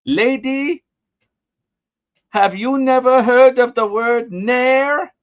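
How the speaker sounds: high, loud, slow broken English; broken English accent. Retold or imitated. Kid insulting the lady back, broken English accent. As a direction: mimic halting foreign-accented English with big drawn-out words. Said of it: "in broken English, said to her".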